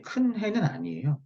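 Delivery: usual speaking voice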